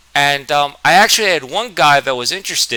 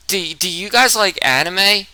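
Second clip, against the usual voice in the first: slow hesitant question, own voice shifted; timid newcomer asking the table; nervous asking; 7 semitones higher than usual. Retold or imitated. New kid nervously asking classmates about anime, slow and hesitant. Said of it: "I literally said".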